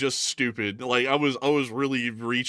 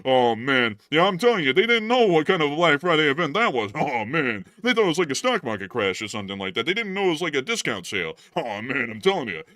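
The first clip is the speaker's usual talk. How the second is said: higher, louder hammed-up comic whine, laughing between lines; Rodney Dangerfield impression; 3 semitones higher, 6 dB louder than usual. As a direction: ham up a put-upon standup who gets no respect. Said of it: "I try and crack a shitty joke as Rodney Dangerfield".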